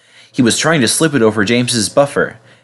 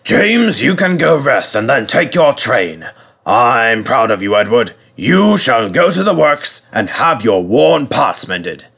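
slow, ceremonious boss voice; Fat Controller. A narrator's reading. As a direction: hand down praise and orders grandly. Fat Controller addressing both engines.

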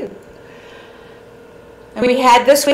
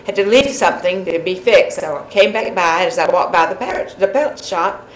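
ordinary talk

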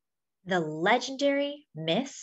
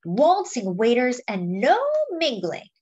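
put-on lively picture-book read to children; story narration; 4 semitones higher than usual. A narrator's reading. Reading a Lena Horne picture book to friends, lively storytelling. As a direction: tell it like a storyteller to a class.

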